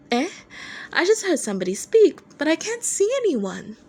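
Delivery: high, mock-innocent teasing; teasing Dazai voice. Dazai teases Chuya about height.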